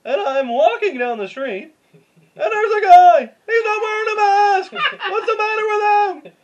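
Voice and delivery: high, loud, slowed observational whine; doing Seinfeld for friends, whiny and loud; Seinfeld impression. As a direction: ham up a stand-up rant with laughs. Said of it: "I can do, like, kind of a Seinfeld".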